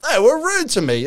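high, whiny complaint; mock a whining tourist; whiny Aussie. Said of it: "Aussies going".

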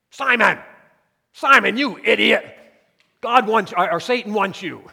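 loud, high, scolding yell; angry tone. Pretend. Preacher mocking an angry Christ scolding Simon. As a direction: bark it at him like a fed-up master. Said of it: "Do you think it was an angry tone of voice?".

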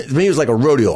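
ordinary talk

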